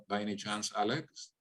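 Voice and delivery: ordinary talk, nothing marked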